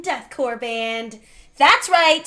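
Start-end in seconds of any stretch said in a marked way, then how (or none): 1.6-2.3 loud hype. Acted. Hyping a deathcore band.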